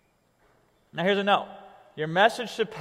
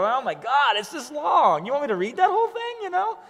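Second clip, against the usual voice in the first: mock disbelief; 7 semitones higher than usual. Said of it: "And I'll look at him".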